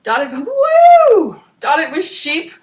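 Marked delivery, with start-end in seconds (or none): 0.0-1.4 a loud high woo as the reader trips over the line; sudden whoop. Real, not acted. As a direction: yelp in surprise mid-sentence. Flub turns into whoop.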